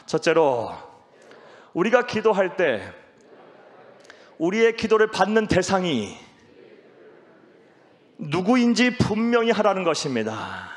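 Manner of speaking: loud, high phrases with long gaps; preacher's loud call-and-repeat; lead for repeating. Shown as a demonstration. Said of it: "따라하시겠습니다".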